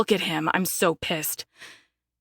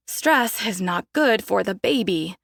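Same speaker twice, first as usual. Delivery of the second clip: usual speaking voice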